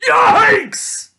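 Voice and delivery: high, loud yelp of alarm; startled yell. A narrator's reading. Father turns and sees the cart, startled yell. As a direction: yelp it in sudden horror. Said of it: "her father turned around and yelled".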